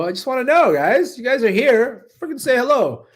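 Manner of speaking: loud, high, playful calling out to the chat; calling out loudly. Real, not acted. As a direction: raise your voice and demand a hello from viewers. Calling out to silent viewers.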